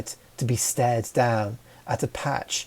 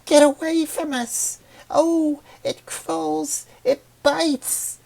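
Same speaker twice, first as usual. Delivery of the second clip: shouting flower; slower than usual. Acted. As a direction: shriek her away like a pest. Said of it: "another shouted".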